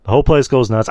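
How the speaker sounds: usual speaking voice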